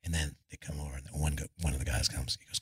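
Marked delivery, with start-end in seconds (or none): none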